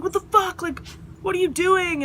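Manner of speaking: high, outraged neighbor; high-pitched indignant challenge; mock yelling. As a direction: imitate a downstairs neighbor demanding answers. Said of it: "got yelled at by".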